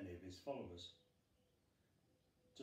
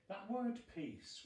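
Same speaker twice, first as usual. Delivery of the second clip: "peace" said slowly, much higher and louder; emphasis on peace; 9 semitones higher, 4 dB louder, slower than usual. Real, not acted. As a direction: lean hard into "peace", slow it down. Preacher dwells on the word.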